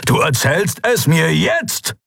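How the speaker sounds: high, slow, forceful demand; barked order. A narrator's reading. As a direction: bark it as an angry father, word by word. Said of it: "bellte er". (wütend gebellter Befehl)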